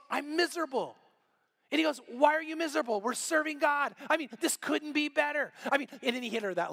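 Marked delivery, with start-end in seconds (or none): 0.1-1.0 mildly whiny retold complaint, higher; complaining wife. Wife complains to her upbeat husband on a road trip, complaining. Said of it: "she starts to complain". 2.1-5.5 high-pitched, upbeat imitated husband; optimistic husband. Husband cheering up his miserable wife, brightly optimistic. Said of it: "the most optimistic guy you've ever met".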